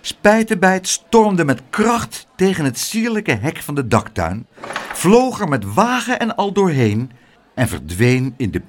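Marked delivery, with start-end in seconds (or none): none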